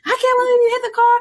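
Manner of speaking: high, loud, fast retold yell at the driver; mom yelling. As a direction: imitate mom ripping into the guy. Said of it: "She's yelling".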